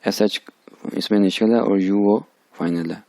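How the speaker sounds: ordinary talk, nothing marked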